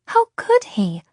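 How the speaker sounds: high-pitched, outraged question; indignant demand. A narrator's reading. Isolde hears Luca dines with an infidel, high indignation. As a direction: pitch it right up in protest. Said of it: "Isolde demanded".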